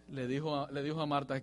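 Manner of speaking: usual speaking voice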